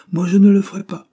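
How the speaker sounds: high, loud protest; forceful indignant retort; emphatic refusal. A narrator's reading. Servant firmly contradicts her mistress.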